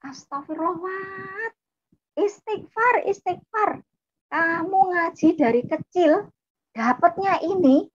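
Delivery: high-pitched scandalised scolding, imitating older sibling; horrified outcry. Older sibling urges younger one to repent, high horrified scolding. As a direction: wail it in shocked reproach. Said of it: "Langsung kakak saya teriak".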